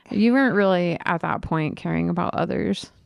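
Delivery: ordinary talk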